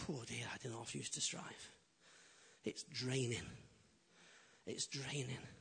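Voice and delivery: low, soft, drained admission; worn-out, rueful and quiet; weary. Retold or imitated. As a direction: sink down as if worn out by effort. Recalls exhausting years of striving.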